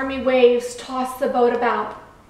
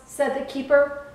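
ordinary delivery

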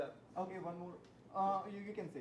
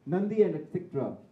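ordinary delivery

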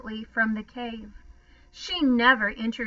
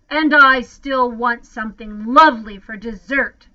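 loud, booming demand; thundering; 10 dB louder than usual. A narrator's reading. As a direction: thunder it like a monster mother. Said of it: "she thundered".

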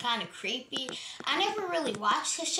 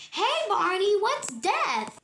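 put-on high-pitched, curious child voice; little kid; 8 semitones higher, 3 dB louder, slower than usual. Kid asks Barney a question, high little-kid voice. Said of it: "a little kid was like".

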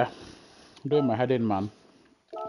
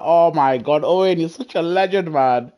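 ordinary talk, nothing marked

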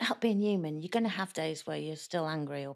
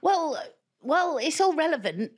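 mock defensive; 11 semitones higher, 5 dB louder than usual. Retold defensive reply to Lee.

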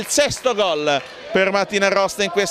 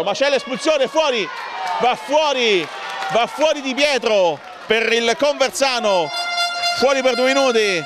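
higher, urgent live call; breathless italian goal commentary; 5 semitones higher than usual. Real, not acted. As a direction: hammer home the send-off. Calling an expulsion live.

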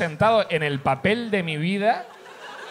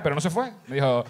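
normal talking voice

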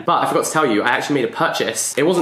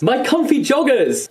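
high, drawn-out thrilled squeal of words; delighted exclamation; 11 semitones higher than usual. Retold or imitated. Daughter seeing what mum brought from home, delighted exclamation. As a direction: exclaim it high, savouring the surprise. Delighted reaction to mum's surprise.

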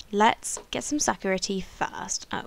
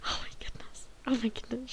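breathless whispered awe; awed whisper; 2 semitones higher, 9 dB quieter, faster than usual. Real, not acted. Player stunned by the guru's prophecy, whispering in awe.

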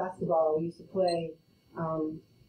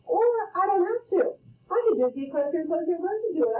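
mock whiny voice; 9 semitones higher than usual. Mimicking student, far, fast.

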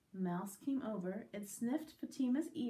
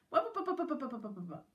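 quick popping running noise; scampering sound effect; 2 semitones higher, faster than usual. Acted. Rabbit running away, quick scampering noise. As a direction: rattle off the patter of fleeing feet.

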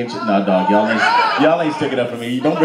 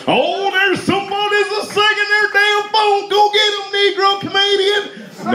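mock crowd instigator. Comedian imitates the crowd siccing him on a phone, high mock cry. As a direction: imitate an egging-on onlooker way up high. Mocking an imagined crowd member's demand.

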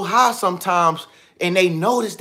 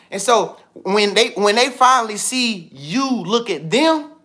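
usual speaking voice

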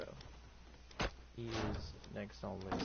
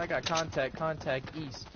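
urgent callout, raised voice; alarm shout; 6 semitones higher, 8 dB louder than usual. Real, not acted. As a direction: shout the enemy position. Enemy spotted in shooter game.

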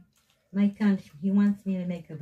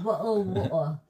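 louder mock British accent; 3 semitones higher, 6 dB louder than usual. Echoing the British guest, imitated British accent. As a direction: copy his British accent loudly. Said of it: "Now he's got a British accent".

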